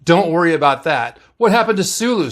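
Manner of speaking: usual speaking voice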